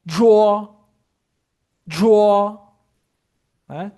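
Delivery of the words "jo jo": jo loud, nasal vowel, curled tongue; model syllable. Shown as a demonstration. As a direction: belt out the model jo. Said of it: "Ou o seguinte". (jo alto e claro como modelo)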